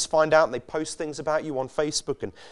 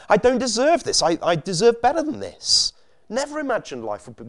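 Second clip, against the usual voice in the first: high, loud indignant protest; 9 semitones higher, 6 dB louder than usual. Retold or imitated. Someone squeezed by life, protesting loudly and indignantly. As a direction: cry out that you deserve better. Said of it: "Some of us get angry, indignant".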